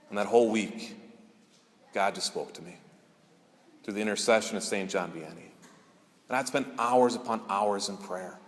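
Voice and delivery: ordinary delivery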